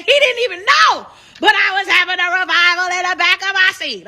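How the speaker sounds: excited preaching cry. Real, not acted. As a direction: let the church shout out of you, mid-testimony. Preaching about her revival on the plane.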